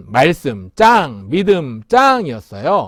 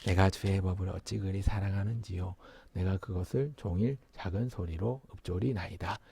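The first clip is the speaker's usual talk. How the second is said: very soft, low murmur; meditative reading in a tiny, low voice; small-voiced reading; 8 semitones lower, 15 dB quieter than usual. Shown as a demonstration. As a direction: recite it quietly to yourself. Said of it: "작은 소리로 내서 읽는 사람".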